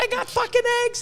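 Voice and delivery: squealing high with excitement, imitating a thrilled new breeder; ecstatic breeder. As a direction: play the new breeder losing it over a first clutch. Breeder jumping around over first clutch.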